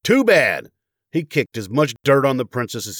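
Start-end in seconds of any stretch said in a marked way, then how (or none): 0.0-0.7 high, loud spiteful retort; angry yell. A narrator's reading. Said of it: "he yelled".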